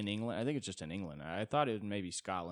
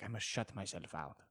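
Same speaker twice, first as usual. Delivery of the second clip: put-on Italian accent. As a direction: brood dramatically in an Italian accent. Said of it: "an Italian would also be like".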